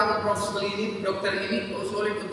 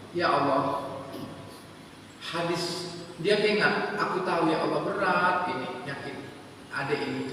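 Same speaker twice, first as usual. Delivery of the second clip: tearful prayer; 5 semitones lower, 7 dB quieter than usual. Acted. Preacher voices mother's tearful prayer.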